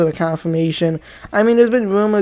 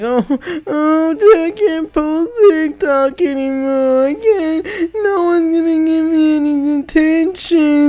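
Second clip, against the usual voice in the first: high, slow whining like a crybaby; mock-whine as a TikTok kid losing the app; whiny crying baby; 9 semitones higher, slower than usual. YouTuber mocking TikTok users, high whiny crybaby voice. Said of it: "They're gonna cry like little babies".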